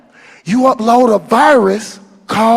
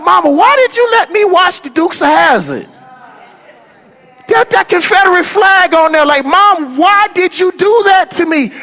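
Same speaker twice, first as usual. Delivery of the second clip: a grown son, outraged at his mom, pitched up; incredulous complaint to mama; 8 semitones higher than usual. Retold or imitated. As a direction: demand an answer from your mother, aghast. Pleading with mama about flag.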